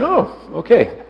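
high, slow pleased oh; gentle surprise. Retold or imitated. Teacher surprised relief.

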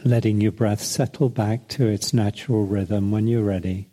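unhurried meditation voice with long pauses; guided meditation. Acted. Leads a breathing meditation.

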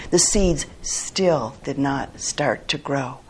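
ordinary delivery

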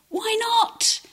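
high, loud imitation of a mother; mock mum shout. Mum questioning her booted-out son, high mock shout.

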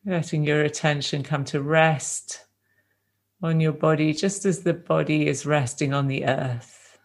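gentle, unhurried meditation guidance with long silences between phrases; guided meditation. Real, not acted. Teacher guiding a group to rest in the body, calm meditation voice. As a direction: ease them into stillness, leaving space after each phrase.

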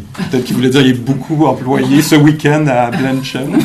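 loud, amused, laughing through the words. Real, not acted. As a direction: let the joke burst into laughter. Joking, room laughs along. (en riant fort)

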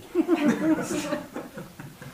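a high, open laugh bursting out; burst of high laughter. Real, not acted. Speaker laughing while retelling the chipmunk story, high and free. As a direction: laugh as the memory hits. Recalling his hysterical laughter.

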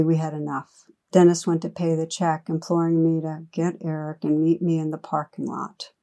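ordinary delivery

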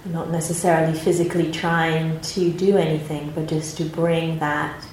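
calm, unhurried meditation guidance with long pauses; guided meditation. Acted. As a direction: gently guide the group into their posture and body.

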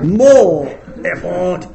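very loud, long-drawn mock roar; straining exhortation. Speaker mimics teacher's final push for third rocket stage, hammed-up straining roar. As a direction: heave the words out slowly at full force.

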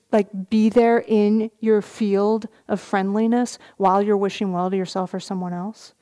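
usual speaking voice

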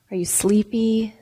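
usual speaking voice

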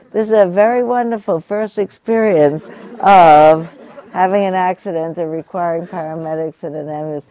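emphatic repeat. Retold or imitated. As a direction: proclaim her words with delight. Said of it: "repeats her words with emphasis".

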